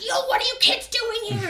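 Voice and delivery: a hint of a yelling mother; mom yelling. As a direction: play the annoyed mom.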